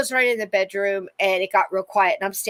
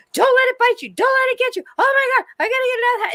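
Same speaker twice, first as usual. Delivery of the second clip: high, loud panicked screaming, the last line rushed; mother screaming; 6 dB louder, much faster than usual. Retold or imitated. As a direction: shriek the warnings in a frantic rush. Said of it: "she's screaming all these things".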